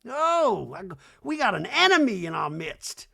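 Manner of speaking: high, loud, slow Reba; mock Reba, scornful and high; hammed-up high Reba voice; Reba impression. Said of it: "She's like".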